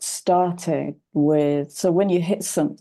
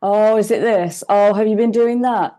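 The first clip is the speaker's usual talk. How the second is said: eager overdrive; 4 semitones higher, 6 dB louder than usual. Retold or imitated. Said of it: "went into overdrive with guessing and fixing".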